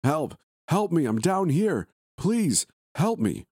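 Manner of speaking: stretched final plea, hollered slowly; shouting for help. Acted. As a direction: yell up from the pit with everything you have. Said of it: "I shouted at the top of my lungs".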